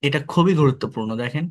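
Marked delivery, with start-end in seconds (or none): none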